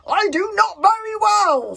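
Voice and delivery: high, loud slow scream. A narrator's reading. Warlord screams about his sore bottom, high and loud. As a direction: scream back in pain. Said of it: "screamed Norbert the Nutjob".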